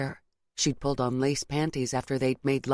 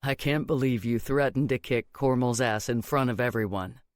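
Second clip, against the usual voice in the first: low-pitched male character voice; incredulous boyfriend; 4 semitones lower than usual. A narrator's reading. Boyfriend recalling her threat before everyone, low and incredulous. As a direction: lower the voice to tease her.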